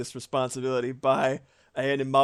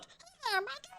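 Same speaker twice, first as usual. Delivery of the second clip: squeaky chipmunk voice, much higher; high chipmunk impression; Alvin voice; 9 dB quieter than usual. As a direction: pitch way up like a cartoon chipmunk. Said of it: "It's like".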